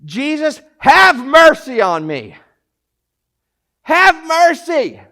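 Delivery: loud, high, drawn-out cry for mercy; desperate yell. Acted. Blind beggar screaming out to Jesus, top-of-lungs yell. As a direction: yell it out slowly at full voice. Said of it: "yelling out at the top of his lungs".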